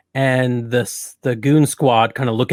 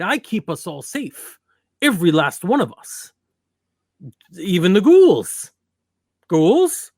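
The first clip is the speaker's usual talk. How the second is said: pompous prince; 5 semitones higher than usual. Acted. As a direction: declare it like a vain king. Prince proclaiming his authority.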